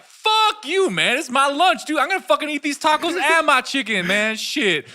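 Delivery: high, loud indignant rant; outraged shouted outburst; yelled. Retold or imitated. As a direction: yell it at them in outrage, voice shooting up. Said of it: "I legit yelled that".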